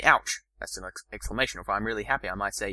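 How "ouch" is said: sharp pained ouch; a quick ouch of pain. Shown as a demonstration. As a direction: yelp it like you bumped the furniture.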